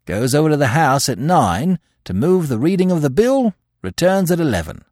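high, forceful, brisk official; reporting police superintendent. A narrator's reading.

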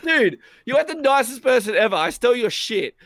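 high, loud, incredulous protest; acted-out flabbergasted outburst; amazed disbelief. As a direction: exclaim it like you can't believe their kindness. Acted amazed confession in retold chat.

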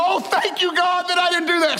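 high relieved oh, thank you, God; relieved dreamer. Retold or imitated. Man waking from a bad dream, hammed-up relief. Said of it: "you wake up and you go".

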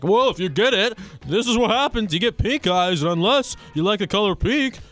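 mocking anti-vaxxer. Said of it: "anti-vax people ... like".

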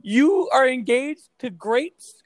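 put-on high, loud, slow drunken question; hammed-up drunk aunt, high and slow; mock drunk tia. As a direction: drag it out like a tipsy aunt. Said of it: "She's like, all drunk as shit".

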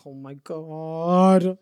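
very slow, stretched-out oh my god; drawn-out dread. Retold or imitated. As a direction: let it drag out in disbelief. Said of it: "I'm like".

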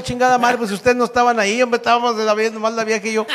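high-pitched protesting retort; hammed-up indignant comeback; mock indignation. Snaps back at audience reaction.

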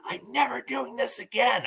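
put-on high, far-off little-girl voice, sulky; little daughter voice. Dad imitating his four-year-old daughter's sulky little voice. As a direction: squeak it out like a small upset child. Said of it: "just hearing her be like".

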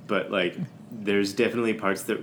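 ordinary talk

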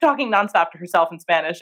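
ordinary talk, nothing marked

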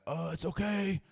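high, slow cop voice; a cop soothing gently in a high voice. Retold or imitated. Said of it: "He's like".

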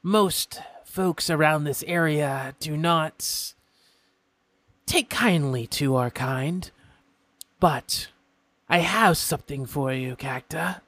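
a polite, curious imp stranger; imp folk voice. Acted.

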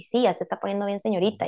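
usual speaking voice

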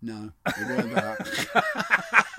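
loud, high laughing reply. Real, not acted. Co-host rejecting the whisper guess, laughing. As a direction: say it through real laughter.

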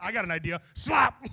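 loud hammed-up Peter yelling Slap; mock Peter shout. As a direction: play Peter gleefully shouting the sound. Peter voiced acting out.

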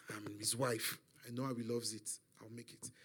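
very low, soft murmur; hushed confiding. Retold or imitated. Mother assures her son she can cook for his father, hushed. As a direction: confide it under the breath, fading away.

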